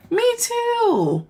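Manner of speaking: very high, drawn-out squeal of kinship; real delighted agreement. Real, not acted. Host answering a viewer about military service, excited agreement. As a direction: chime in excitedly.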